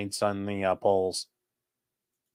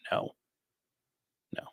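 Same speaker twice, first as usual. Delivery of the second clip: ordinary talk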